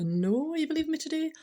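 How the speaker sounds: ordinary talk, nothing marked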